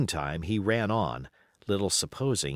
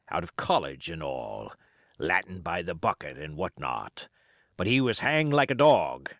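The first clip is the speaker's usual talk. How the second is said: put-on old pirate. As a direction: spin yarns like a scheming old pirate. Silver's pirate voice.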